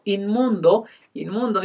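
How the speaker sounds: ordinary talk, nothing marked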